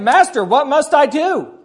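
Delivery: high, loud, slow desperate asking; an eager young man pleading with his teacher; urgent plea. Retold or imitated. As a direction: beg the master for an answer. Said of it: "Can't you picture urgency here?".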